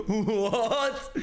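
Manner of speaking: high-pitched, laughing shock; laughing disbelief. Real, not acted. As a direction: squeal it through laughter. Incredulous at the clip's pronunciation.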